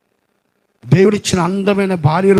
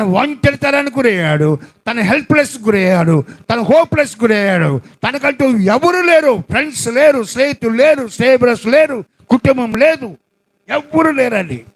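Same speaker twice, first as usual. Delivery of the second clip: full-force preaching; 5 semitones higher than usual. Acted. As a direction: proclaim the loneliness with force. (గట్టిగా బోధించే స్వరం)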